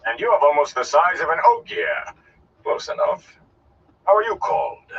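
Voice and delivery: high, theatrical exclamation; appraising gleeman. Acted. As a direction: marvel at the lad with a showman's flourish. Gleeman addresses another lad.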